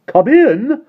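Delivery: high, loud call to the door; cried out. A narrator's reading. Mister Wig calling to the visitor, high and loud. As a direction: call out to the knock. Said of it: "cried Mister Wig".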